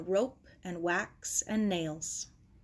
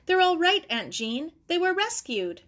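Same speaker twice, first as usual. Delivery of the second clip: higher and louder, bursting with the good news; excited relief; 8 semitones higher, 4 dB louder than usual. A narrator's reading. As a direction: blurt out the rescue news to the worried aunt. Runs to tell anxious aunt.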